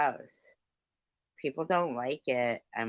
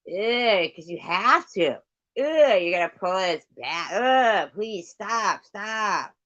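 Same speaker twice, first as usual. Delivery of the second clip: loud, high disgusted groaning pleas; disgusted pleading; 6 semitones higher, 7 dB louder, slower than usual. Real, not acted. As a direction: beg them to stop, grossed out.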